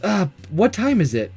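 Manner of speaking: ordinary delivery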